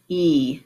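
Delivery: e as the long "ee" in keep; isolated vowel sound. Shown as a demonstration. Teacher giving the new vowel, e said as long "ee". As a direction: say e alone as a long "ee". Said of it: "change the vowel sound".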